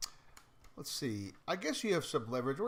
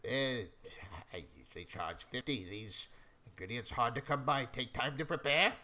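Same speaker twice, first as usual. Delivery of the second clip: lightly voiced shopkeeper; 2 semitones lower than usual. Shopkeeper defending the price of potions, lightly voiced haggler. Game master plays shopkeeper.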